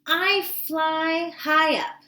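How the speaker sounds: rooster voice. Acted. Teacher voicing the rooster for the kids, proud and high. Rooster speaks in read-aloud.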